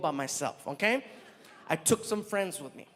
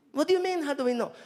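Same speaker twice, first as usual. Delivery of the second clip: driver puzzled; 6 semitones higher, faster than usual. Retold or imitated.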